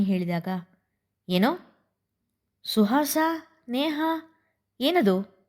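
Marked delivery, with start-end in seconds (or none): 1.3-5.4 sharp, higher demanding question; harsh demand. A narrator's reading. Father Vageesha catching the mothers talking, a harsh demand. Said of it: "ವಾಗೀಶನ ಕಟುದನಿ".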